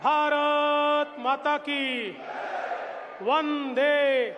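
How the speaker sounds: shouted rally chants, slow and loud; roaring rallying speech. Acted. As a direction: lead the crowd in chanting slogans.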